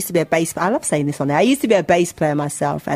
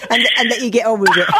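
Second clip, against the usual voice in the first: laughing; 5 semitones higher than usual. Real, not acted. Host laughing through the reply.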